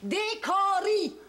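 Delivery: « Des Caries » très lent, la deuxième syllabe appuyée et plus forte; martèle « Caries », syllabe après syllabe; accent sur la deuxième syllabe. Shown as a demonstration. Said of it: "Avec l'accent, c'est la deuxième syllabe". (« Des Caries » lent, avec le a grave québécois en o)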